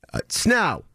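usual speaking voice

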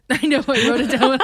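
giggling through the words; laughing. Real, not acted. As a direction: laugh while admitting it. Laughing defensively.

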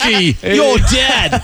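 mock Australian alarm; Australian accent. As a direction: exclaim it like a cartoon Aussie. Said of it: "I can talk like".

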